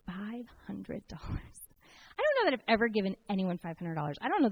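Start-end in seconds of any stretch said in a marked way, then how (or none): 0.0-1.6 quiet, deliberate voice of the Holy Spirit; hushed divine prompting. Retold or imitated. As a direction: softly voice God's instruction, slowing right down on the amount. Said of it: "felt the Holy Spirit say".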